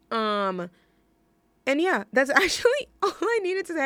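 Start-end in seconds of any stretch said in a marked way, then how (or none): 1.7-3.8 amused real laugh mid-line. Real, not acted. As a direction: laugh through it, pitched higher and fast. Laughing at her own story.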